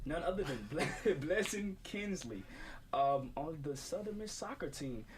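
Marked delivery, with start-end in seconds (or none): 0.1-2.4 laughing. Real, not acted. Laughing through the name.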